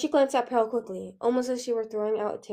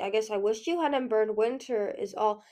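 ordinary delivery

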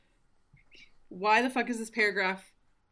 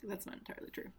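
quick whispered self-correction; whispered confession; whispered aside; 15 dB quieter, much faster than usual. Real, not acted. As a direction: mutter it under your breath to yourself.

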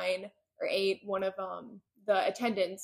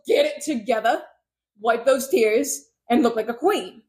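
mock stern command; 3 semitones higher, 8 dB louder than usual. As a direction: order someone to pull themselves together. Voicing heroine's pep talk to crier.

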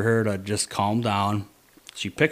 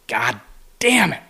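sharp burst of frustration, much higher and louder on damn it; frustrated curse; 4 semitones higher, 5 dB louder than usual. Retold or imitated. Hunter cursing as the spooked buck runs off, frustrated outburst. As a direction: blurt it out as the buck bolts.

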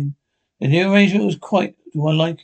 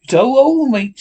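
higher, louder greeting; hailing call; 7 semitones higher, 6 dB louder, slower than usual. A narrator's reading. Silver hailing the crew, loud call. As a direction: call out to a shipmate.

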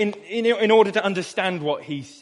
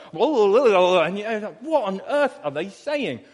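thick Suffolk mumble; 2 semitones higher than usual. Retold or imitated. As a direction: mumble like marbles in your mouth, Suffolk. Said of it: "mouthful of marbles with the Suffolk accent".

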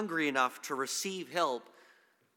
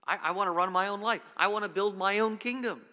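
higher, louder, quick assertion of self-will; raised-voice defiance; defiant declaration; 7 semitones higher, 7 dB louder than usual. Acted. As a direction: voice a rebel insisting on independence. Voicing humanity's defiance of God.

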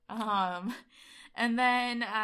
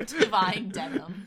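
laughing; 8 dB louder, slower than usual. Real, not acted. Laughing through the joke.

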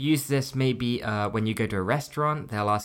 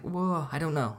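higher, mildly overwhelmed reaction; mock bewilderment; 5 semitones higher, 4 dB quieter than usual. Customer faced with all these questions, mock bewildered. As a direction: play the flustered first-time customer, lightly.

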